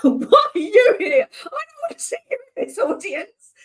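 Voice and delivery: high, laughing mock wail; mock-horrified laughing shout; laughing panic. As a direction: cry out between laughs in horror. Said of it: "I was like".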